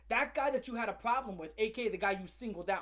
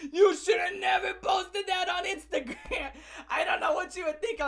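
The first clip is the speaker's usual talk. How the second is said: laughing shout; 8 semitones higher, 7 dB louder than usual. Real, not acted. Ranting at the comedian about Instagram, laughing loud. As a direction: bellow it through laughter. Laughing outburst at her post.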